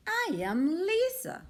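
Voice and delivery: put-on a girl introducing herself to a bear; little girl. A narrator's reading. Little girl voiced in story.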